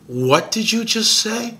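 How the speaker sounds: stunned disbelief. Retold or imitated. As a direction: drag out the shock of hearing it.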